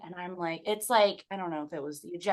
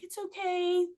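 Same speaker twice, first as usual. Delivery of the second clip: very high, held-out reassurance; strained reassurance; 12 semitones higher than usual. Retold or imitated. Mother in labor to her sleepy son, high strained reassurance. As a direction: imitate reassuring someone through pain.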